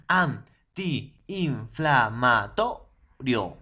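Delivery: An-ti-in-fla-ma-to-rio, every syllable drawn out; syllabified slowly. Shown as a demonstration. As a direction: spell out Antiinflamatorio syllable by syllable, loud and slow. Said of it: "no se separa". (Antiinflamatorio silabeado lento y fuerte)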